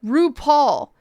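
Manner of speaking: usual speaking voice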